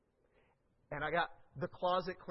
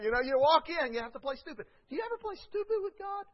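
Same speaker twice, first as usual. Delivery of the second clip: ordinary talk, nothing marked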